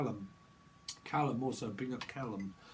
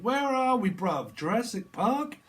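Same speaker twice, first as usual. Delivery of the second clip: high, loud puzzled question; mock lost visitor; 9 semitones higher, 6 dB louder than usual. Medium voices a lost visitor, high and loud. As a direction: call out like someone lost in a dinosaur park. Acted confused dinosaur visitor.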